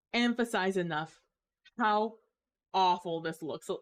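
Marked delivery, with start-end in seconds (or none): none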